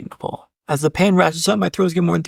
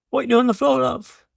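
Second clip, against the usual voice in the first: a lightly done British nurse, pitched higher; imitating a British nurse with a raised voice; mock British accent; 6 semitones higher than usual. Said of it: "That's a fantastic British accent you have there".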